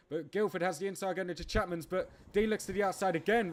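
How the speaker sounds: fast, high, loud play-by-play; excited race commentary. Acted. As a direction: call the race as it happens. Live race commentary building up.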